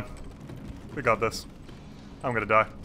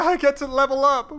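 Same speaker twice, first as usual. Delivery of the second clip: excited; 11 semitones higher, 4 dB louder than usual. Real, not acted. Reaching grace.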